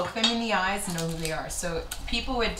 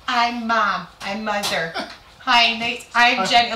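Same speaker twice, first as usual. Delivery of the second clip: loud, higher grand introduction; hammed-up queenly mom; self-important mother impression; 4 semitones higher, 8 dB louder, slower than usual. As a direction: greet everyone like visiting royalty. Mother grandly introducing herself, acted.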